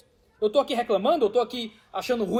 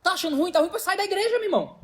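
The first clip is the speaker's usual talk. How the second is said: higher, altered mock challenging voice; daring the complainer to leave; provoking confrontation; 7 semitones higher than usual. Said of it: "alguém olha pra gente e diz". (voz provocadora e desafiadora, mais aguda)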